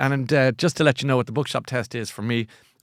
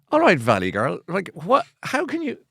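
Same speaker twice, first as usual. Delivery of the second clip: lightly acted disbelief, pitch swooping up; exasperated retort to an imagined journalist, played up; mock incredulity; 5 semitones higher than usual.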